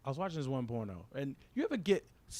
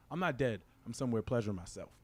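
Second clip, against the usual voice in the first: normal talking voice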